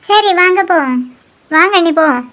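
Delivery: ordinary delivery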